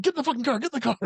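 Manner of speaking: high-pitched urgent order; hammed-up high alarm; mock urgent panic. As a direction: imitate frantic urging during a getaway. Acting out an urgent escape.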